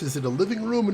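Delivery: ordinary talk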